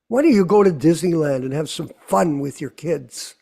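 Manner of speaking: ordinary talk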